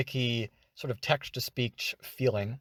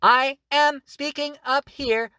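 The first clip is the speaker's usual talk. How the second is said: high robot voice; 11 semitones higher, 6 dB louder than usual. Shown as a demonstration. Tutor showing higher pitch with the same robotic pattern. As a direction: lift it way up and keep the robotic beats. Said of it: "I can change the pitch with that same".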